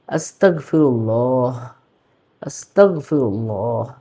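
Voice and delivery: আস্তাগফিরুল্লাহ with a clear g and a final h, said slowly; correct pronunciation. Shown as a demonstration. Teacher giving viewers the correct form, slowly. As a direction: voice the g and end আস্তাগফিরুল্লাহ with h. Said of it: "শুদ্ধটা কি হবে?".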